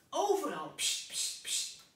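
loud "pst, pst" spray noises; spray can hiss. Retold or imitated. Mr. Pen imitates Mrs. Helderder spraying, hissing noise.

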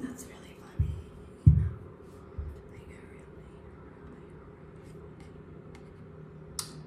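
a bitter joke whispered to herself; whispered, fading. Real, not acted. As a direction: sink into an unvoiced whisper that fades away. Trailing whispered aside.

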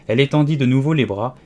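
plain reading voice

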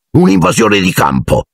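high, mocking jeer; mock the newcomers from above; sneering jeer. Flint mocks the intruders. (scherno acuto e beffardo)

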